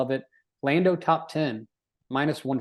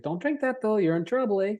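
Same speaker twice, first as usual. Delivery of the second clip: put-on Canadian accent; 8 semitones higher than usual. Mock Canadian warning.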